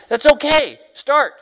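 high, loud encouragement; loud, high, eager prodding; urging. Retold or imitated. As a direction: push her to start now. Preacher voices his own urging.

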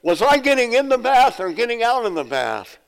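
high-pitched comic call from upstairs; yelling downstairs. Acted. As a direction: act out a confused old sister calling down. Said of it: "she yelled downstairs".

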